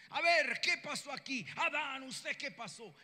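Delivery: loud, high-pitched scolding yell; shouting. Acted. God confronting Adam in the garden, shouting. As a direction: burst in yelling, demanding an explanation. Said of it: "Dios debía haber llegado gritando".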